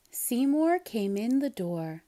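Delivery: usual speaking voice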